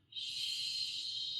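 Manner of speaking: soft sustained shushing exhale; long quiet shh breath. Shown as a demonstration. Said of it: "and make the shush".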